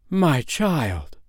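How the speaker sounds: astonished mother, without extra volume; amazed. A narrator's reading. Mother sees her lame child run, amazed cry. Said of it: "cried her mother in amazement".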